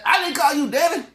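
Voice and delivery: put-on high, loud child's whine; whiny kid voice. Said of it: "he's like".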